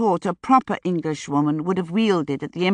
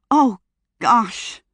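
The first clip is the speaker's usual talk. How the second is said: sudden high yelp of alarm; startled exclamation; 4 semitones higher than usual. A narrator's reading. Sunny noticing her coat burning, startled exclamation. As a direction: caught off guard by her smouldering fur. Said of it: "she exclaimed".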